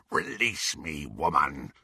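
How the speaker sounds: low, quiet werewolf voice; werewolf growl. A narrator's reading. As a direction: growl the demand. Greyback voiced in narration.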